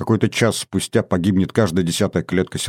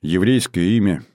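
ordinary delivery, nothing marked

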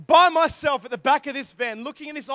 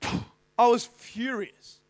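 ordinary talk

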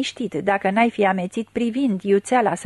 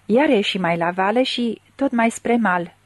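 ordinary delivery, nothing marked

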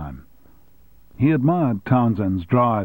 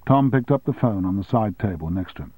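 usual speaking voice

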